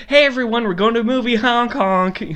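high, loud goofy announcer; cheerful car-trip greeting, goofy and loud; silly clown. Pretend. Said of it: "just being like".

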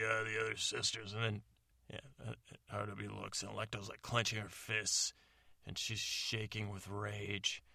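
ordinary delivery

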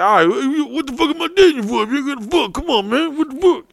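high-pitched, whiny griping; mock cranky complaint. Cranky boyfriend to girlfriend, high mock whining. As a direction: whine it like a frustrated boyfriend. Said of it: "at first I get cranky".

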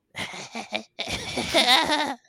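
high cackling hag laugh; hag cackle. Acted. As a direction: cackle like a waking witch. Hag starts cackling awake.

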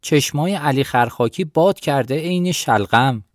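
loudly ranting. A narrator's reading. Headman cursing the Shourabi folk before Jabbar, loud ranting. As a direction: rail against the villagers for all to hear. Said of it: "کدخدا هنوز بلند بلند میگفت".

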